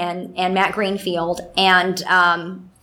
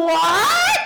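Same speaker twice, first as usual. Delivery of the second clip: baffled what; 11 semitones higher than usual. Retold or imitated. Baffled reaction to second fan.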